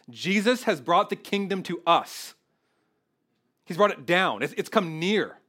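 full-force preaching. Acted. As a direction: drive the good news home from the pulpit.

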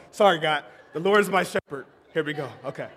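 usual speaking voice

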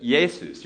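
"Jesus" said as YAY-soos, with a y; Greek pronunciation. Shown as a demonstration. Pastor teaches church the Greek name, Greek pronunciation of "Jesus" as YAY-soos. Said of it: "We would pronounce it".

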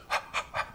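a quiet sharp gasp of dismay; mock gasp. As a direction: gasp as if horrified. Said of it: "first Moses' reaction is".

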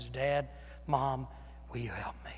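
a small, quiet call for help; whispered plea. Retold or imitated. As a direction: grown child whispering to parents for help. Grown child calling parents.

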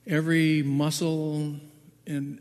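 normal talking voice

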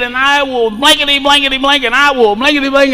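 high-pitched, censored angry rant; mock cussing out. Woman cussing a lady out in a fight, mock angry. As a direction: imitate a woman cussing someone out. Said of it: "cussing this lady out".

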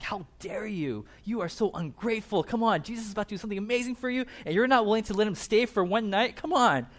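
acted-out exasperation rising to a shout; angry disciples. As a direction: scold them in mounting outrage. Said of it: "they're just angry".

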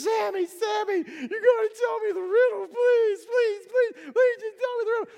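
high, loud, whiny wifely begging; hammed-up frantic pleading in a squeaky high voice; pleading nagging wife. Wife begging Samson for the riddle, high-pitched pleading.

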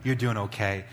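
gentle kind words to a stranger; reassuring. Retold or imitated. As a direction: comfort a worn-out mother quietly. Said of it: "I said".

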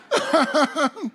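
high, loud burst of laughter; big high cackling laugh. Real, not acted. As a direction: laugh heartily at your own joke. Laughs after own punchline.

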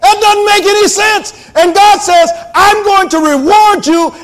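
full-force preaching, pitched high; shouting preacher. Real, not acted. Preacher urging the congregation to trust God, shouting. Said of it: "I'm shouting and screaming and spitting and turning red".

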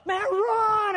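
high, loud panicked yell; shout. Retold or imitated. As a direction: shout run like a bear is coming. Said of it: "Dwayne yells".

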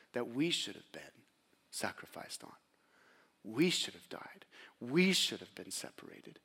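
whispered, solemn confession; hushed whisper. Real, not acted. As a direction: drop to a near whisper.

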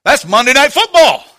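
loud mock protest; spouted-up outburst. Preacher imitating guy at men's meeting, loud outburst. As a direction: blurt it out loudly, imitating an objector. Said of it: "one of the guys in this meeting spouted up".

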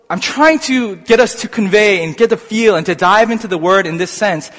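ordinary talk, nothing marked

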